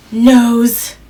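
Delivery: jump-scare punch; sudden loud reveal. A narrator's reading. Storyteller surprising listeners with a loud reveal. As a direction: burst out on the last word.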